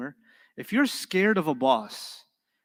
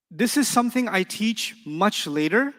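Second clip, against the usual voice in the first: usual speaking voice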